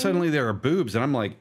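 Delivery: usual speaking voice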